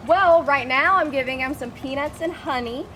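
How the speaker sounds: ordinary delivery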